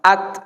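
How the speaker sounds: "At" cut off sharply, a little puff of air; Arabic ta. Shown as a demonstration. Teacher models the ta for students, clipped with a small puff. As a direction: stop the voice dead on the t. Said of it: "Fokus, putus suara, angin keluar sedikit".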